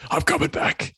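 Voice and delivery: whispered crime boss threat; breathy menacing Kingpin promise; Wilson Fisk impersonation. Pretend. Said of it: "Wilson Fisk impersonation".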